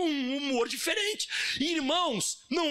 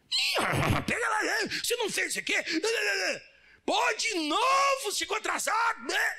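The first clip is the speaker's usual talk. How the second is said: rude boss yelling; 8 semitones higher than usual. Acted. Boss scolding an employee who came in late, yelling. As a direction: bark at the late worker like a brute boss.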